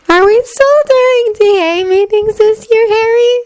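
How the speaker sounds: put-on high, slow dreamy girl; dreamy Luna voice. A narrator's reading. Luna asking Harry about meetings, high and slow. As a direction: ask it slowly, far away in thought.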